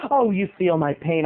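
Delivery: ordinary talk, nothing marked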